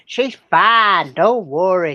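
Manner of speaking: loud Southern drawl for the husband; Kentucky husband in a loud Southern accent. A narrator's reading. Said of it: "Usually you do a Southern accent".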